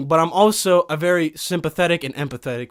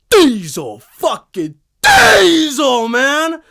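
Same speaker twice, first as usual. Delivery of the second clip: drawn-out, crying shout, higher and louder; excited yell; 6 semitones higher, 6 dB louder, much slower than usual. Pretend. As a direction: bellow it like a hyped-up warrior. Viking fantasy hype.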